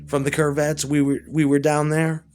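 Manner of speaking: ordinary delivery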